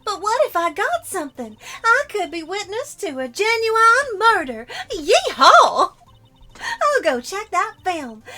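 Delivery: bubbly country gal voice; southern uwu voice. Acted. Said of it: "the southern accent uwu voice".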